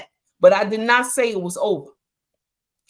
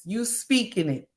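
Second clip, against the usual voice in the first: usual speaking voice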